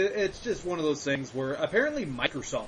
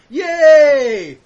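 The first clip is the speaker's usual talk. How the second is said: loud high sarcastic yay; a burst of fake celebration; mock cheer; 8 semitones higher, 13 dB louder than usual. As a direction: cheer it out big, tongue in cheek. Cheering the news.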